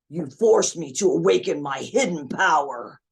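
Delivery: intense anime hero declaration; Gohan. Shown as a demonstration. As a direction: declare it with dramatic, punched menace. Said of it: "new attachment to the line".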